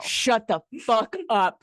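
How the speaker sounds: slow, drawn-out shocked disbelief; stunned disbelief. Real, not acted. Friend hearing the twist, slow stunned disbelief. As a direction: let the shock stretch every word.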